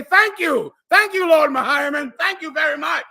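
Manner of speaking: high, loud, exaggerated gratitude; mock grateful thanks. Grateful recipients thank Lord Mahiman, high mock gratitude. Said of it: "they're gonna say".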